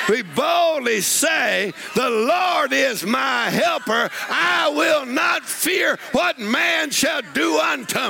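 slow, high, defiant proclamation; bold attitude. Shown as a demonstration. Preacher showing the congregation how to declare boldly, slow and high. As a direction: declare it like you fear nothing. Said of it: "say it with an attitude".

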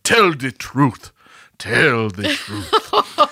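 drawn-out, mock-impassioned plea; hammed-up movie-trailer emotion, very slow; emotional Will Smith. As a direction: imitate an overwrought, clenched-up film hero. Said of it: "so emotional about it and he's just like".

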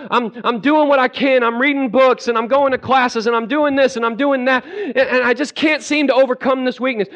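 high, frantic prayer building to a loud cry; dramatic desperate plea. Acted. Believer begging God for help with temper, desperate plea. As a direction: beg with hammed-up desperation. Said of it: "Maybe not this dramatic".

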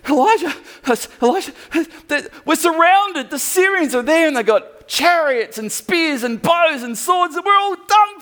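high, loud frantic cry of alarm; panicked servant. Acted. Servant Gehazi bursting in to warn the prophet, high panicked yelling. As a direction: voice the terrified servant shrieking the news.

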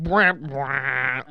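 sad-trombone noise sung by mouth; imitated comic horn. Capping a mocked fat joke, imitated comic horn. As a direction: honk out a wah-wah punchline sting.

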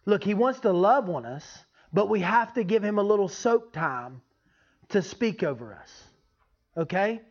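ordinary talk

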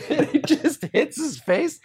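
high, laughing storytelling; laughing while telling. Real, not acted. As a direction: tell it through real laughter. Laughing at his own prank story.

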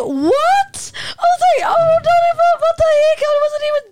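very high, loud squeal of protest; frustrated disbelief. Retold or imitated. Retelling a rebuff at the club, frustrated outraged squeal. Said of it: "so frustrated by that".

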